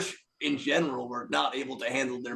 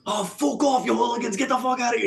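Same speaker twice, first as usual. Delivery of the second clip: high-pitched indignant outburst, imitated; acted-out scolding, pitched well up; angry telling-off; 7 semitones higher than usual. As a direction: mimic a defender chasing troublemakers away. Acting out man shooing off hooligans.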